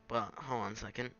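normal talking voice

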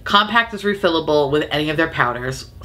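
normal talking voice

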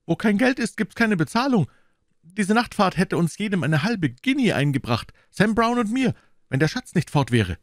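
höhere, etwas lautere Stimme des grummelnden Polizisten; missmutiger Polizist. A narrator's reading. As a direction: als enttäuschter Droschkenpolizist klagen, höher. Policeman voiced by reader. (Polizist klagt über entgangenen Lohn, höher und verdrossen)

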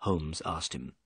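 ordinary delivery

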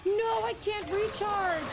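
high, slow, stretched-out cry; panicked wail. Real, not acted. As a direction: wail helplessly as the game goes wrong. Cannot recharge, about to die.

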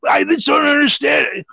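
high, exasperated outburst; retold frustration. Tearing the place up as houseguest watches, high retold frustration. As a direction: throw your voice up high in mock exasperation. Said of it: "I was getting frustrated".

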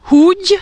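Húgy with a strong H, high and loud. Shown as a demonstration. Teacher shows Húgy with H. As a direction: blow out the H in Húgy. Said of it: "entendez la différence".

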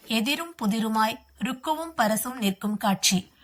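plain reading voice

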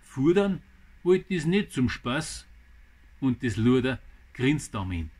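dramatic Bavarian verse recital in short phrases; poem recital. Acted. Gardener reads viewers his poem about a mouse, dramatic recital. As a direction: recite it line by line with pauses, lively rise and fall.

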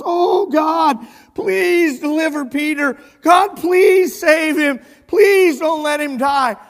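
loud, high, desperate pleading; passionate prayer. Retold or imitated. Church praying for Peter's life, impassioned plea. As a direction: beg God at full voice, acting out the congregation. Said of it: "praying with great passion and bitterness".

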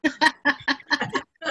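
bright real laugh rising. Real, not acted. Speaker laughing at her covid elevator joke.

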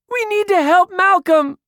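put-on high, pleading little girl; tearful child. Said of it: "Tears streamed down Ruby's face".

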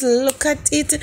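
ordinary talk, nothing marked

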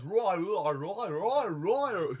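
hammy slurred Cockney "right" repeated. Retold or imitated. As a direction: mash the Cockney rights together. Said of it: "He really kind of slurs his accent".

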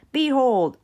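loud, proclaiming "Behold"; grand announcement. Acted. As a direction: proclaim it like a royal herald. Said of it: "announced the princess".

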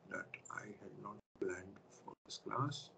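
barely voiced whisper, muttered to himself; whispered aside. Real, not acted. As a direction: drop to a whisper as if talking to yourself. Aside to self while teaching.

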